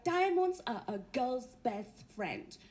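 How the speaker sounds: low, slow quoted star line; slow mock-glamorous quotation; Elizabeth Taylor. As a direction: drop the pitch and draw out the famous saying. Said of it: "it was Elizabeth Taylor that said".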